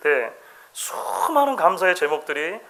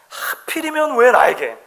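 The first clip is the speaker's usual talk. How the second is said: higher, slow whining complaint; complaining to God; 7 semitones higher, slower than usual. Retold or imitated. Professor complaining to God about his lot, mild whine. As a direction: grumble plaintively upward. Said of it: "하나님 앞에 불평하는 일들이 많았다는".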